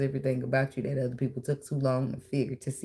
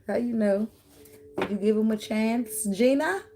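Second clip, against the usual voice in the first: playful challenge; 8 semitones higher than usual. Acted. Scolding the card-reading client playfully.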